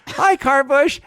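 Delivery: put-on high, loud little-boy greeting; son. Son answers the talking bush, imitated high child voice. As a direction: imitate a kid calling hello. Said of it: "And he goes".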